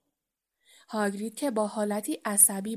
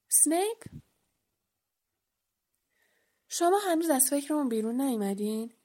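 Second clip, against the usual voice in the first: surprised; 5 semitones higher, 3 dB louder, faster than usual. A narrator's reading. Boy's disbelief at a name.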